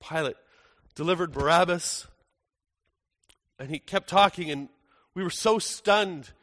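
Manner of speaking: ordinary talk, nothing marked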